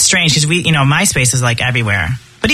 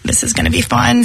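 begging; 6 semitones higher, 4 dB quieter than usual. Retold or imitated. Host begging guests by email to join, mock pleading. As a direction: beg sweetly, drawing out the please. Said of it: "begging them on email".

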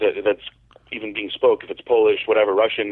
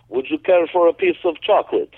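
a polite, gentle giant's offer, a bit higher; imitating soft-spoken Robert; 6 semitones higher, 3 dB louder than usual. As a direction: mimic the huge bodybuilder's mild, courteous voice. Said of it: "he's so soft spoken and, and just friendly".

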